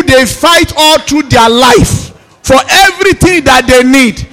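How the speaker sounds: loud, rhythmic prayer leading; preacher calling out blessings over the congregation; fervent prayer. Acted. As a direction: lead the church in declaring prayer.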